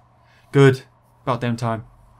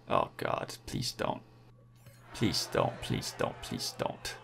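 breathy whispered please don't; whispered pleading; 12 dB quieter, slower than usual. Real, not acted. Gamer whispering prayers as the peloton closes in. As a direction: barely voice it, praying they don't catch you.

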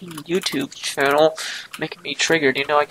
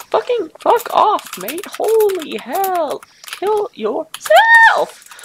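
much higher, louder, slow hammed-up yelling; mock rage yell; 10 dB louder, much slower than usual. Gamer raging at opponents, hammed-up furious yell. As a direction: scream it out like a tantrum.

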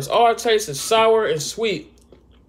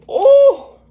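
surprised; 9 semitones higher, 6 dB louder, much slower than usual. Real, not acted. Sour taste reaction.

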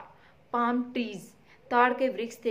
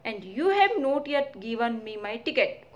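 ordinary talk, nothing marked

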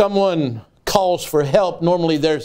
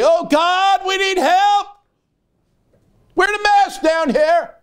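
desperate prayer; slower than usual. Retold or imitated. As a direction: plead with God in desperation. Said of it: "he'd say".

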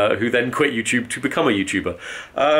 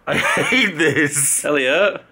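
drawn-out anguished cry; mock anguish; 3 semitones higher, much slower than usual. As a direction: wail it slowly in mock despair. Mock horror at becoming friend.

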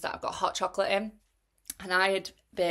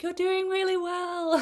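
bright encouraging call, much higher; cheery marshal; 12 semitones higher than usual. Retold or imitated. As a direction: cheer a struggling runner on, sing-song and high.

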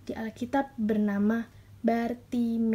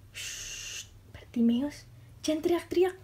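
long shush, then a higher-pitched telling-off; hushing scolding; 6 semitones higher than usual. Acted. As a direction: shush him and tell him off as an annoyed crowd. Crowd hushes the shouting Bartimeus. (menegur sambil mendesis menyuruh diam)

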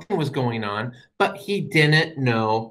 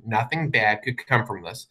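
normal talking voice

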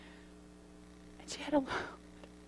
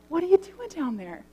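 a hint of a small girl's curious question, higher; lightly voiced little girl; 7 semitones higher than usual. Pastor voicing the little girl asking her dad, lightly childlike. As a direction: ask it like a three-year-old, lightly.

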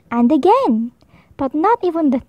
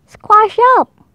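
higher, louder squeezed complaint; crowded badgers; 7 semitones higher, 5 dB louder than usual. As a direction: push back at the big guest. Badgers crammed in hole.

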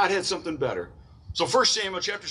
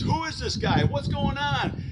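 put-on higher, eager boy asking around; a small kid butting in with questions, voice up; lightly voiced boy David, pitched up; curious young David; 8 semitones higher than usual. Little David asking the soldiers.

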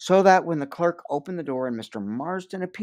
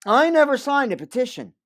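defiant outburst; 12 semitones higher, 8 dB louder than usual. Acted.

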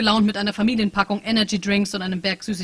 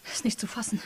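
whispered disbelief; 7 dB quieter than usual. Acted. The bug whispering to itself in disbelief. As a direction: whisper it, stunned.

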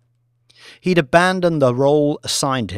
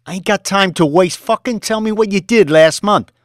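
fast, loud, high badgering; demanding shout; 8 semitones higher, 7 dB louder than usual. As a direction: hammer him for an answer. Boss demanding figures.

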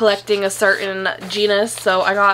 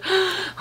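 sharp gasp; 10 semitones higher, 5 dB quieter than usual. Real, not acted. Seeing the plant.

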